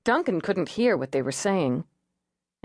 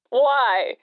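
louder, higher, wary jumper; suspicious man on a roof; 6 semitones higher, 5 dB louder than usual. Acted. Jumper on the roof answers the negotiator, suspicious. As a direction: bark it back, guarded and defensive.

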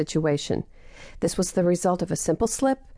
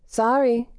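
higher, called up into the canopy; calling up; 5 semitones higher than usual. Acted. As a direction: call an apology upward. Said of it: "she called to Deborah".

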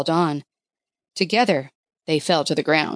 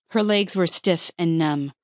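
ordinary delivery

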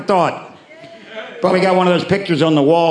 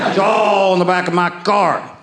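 ordinary delivery

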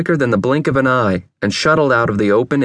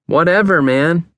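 higher, dismissive retort; curtly unsympathetic, raised pitch; unsympathetic brush-off; 6 semitones higher than usual. A narrator's reading. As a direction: dismiss him without sympathy. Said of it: "was the unsympathetic response again".

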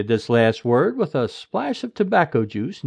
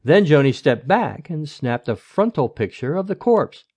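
ordinary delivery, nothing marked

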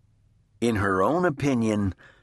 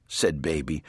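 usual speaking voice

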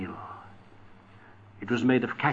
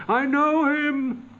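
much higher, slow cry of recognition; 13 semitones higher, 5 dB louder, slower than usual. Acted. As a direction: call it out high and drawn, startled. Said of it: "as though it cried".

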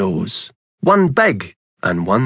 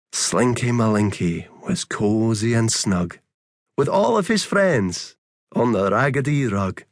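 softer and softer, winding down to sleep; fading bedtime story; 6 dB quieter than usual. A narrator's reading. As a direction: let the story drift off into a hush. Bedtime ending fades to quiet.

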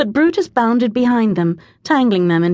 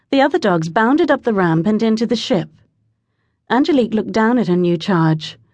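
ordinary delivery

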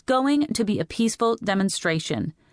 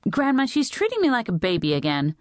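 put-on higher-pitched teenage grievance; whiny complaint; 6 semitones higher than usual. A narrator's reading. Teenager complaining to grandmother about her mother, mildly whiny. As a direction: appeal to Grandma, aggrieved.

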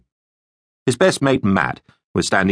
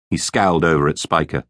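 plain reading voice

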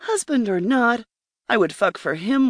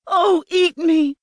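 high, louder, drawn-out cry; 10 semitones higher, 5 dB louder than usual. A narrator's reading. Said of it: "I cried".

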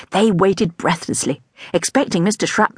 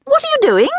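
much higher, curious question; curious teacher; 3 dB louder than usual. A narrator's reading. New teacher asking the children what they are doing, much higher. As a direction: ask brightly and surprised.

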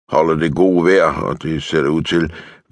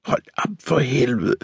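higher, suppressed fury; soft but vehement protest; fierce restrained snarl; 8 semitones higher, 5 dB quieter than usual. A narrator's reading. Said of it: "snærer hun indædt".

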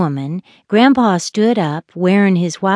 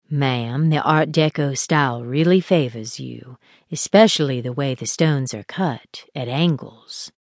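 Marie's low voice; 5 semitones lower, 4 dB quieter than usual. A narrator's reading.

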